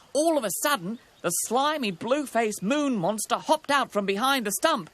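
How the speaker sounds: excited storyteller; dramatic narration. A narrator's reading. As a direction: build to the monster's sudden appearance. Narrator at sudden monster appearance.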